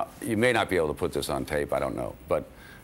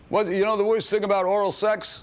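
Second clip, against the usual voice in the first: much higher, louder mock Rodney voice; lightly hammed-up Rodney delivering a punchline; imitating Rodney; 14 semitones higher, 4 dB louder than usual. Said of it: "He says".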